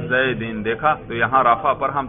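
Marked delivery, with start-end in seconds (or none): 0.1-0.6 Zaid ending in -in, "زیدن" as zay-din; genitive ending. Shown as a demonstration. Teacher shows students the jarr ending, "زیدن" said zay-din. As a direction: pronounce "زیدن" lower, with final -in. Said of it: "جر پہ تلفظ کرو".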